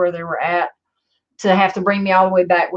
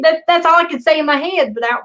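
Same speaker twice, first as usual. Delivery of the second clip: normal talking voice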